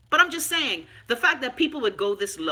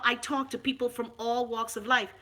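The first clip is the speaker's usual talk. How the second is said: ordinary delivery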